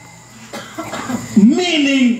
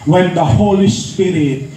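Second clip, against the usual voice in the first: lower, slow and measured Bible verse; solemn scripture reading; 8 semitones lower, slower than usual. Acted. Preacher reciting Acts to the church, slow and solemn. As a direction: read the verse slowly like a sacred proclamation.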